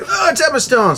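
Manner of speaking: high-pitched gushing squeal; starstruck outburst. Retold or imitated. Spotting a film star at the counter, starstruck squeal. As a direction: mimic failing to play it cool. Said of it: "trying to pretend to be cool".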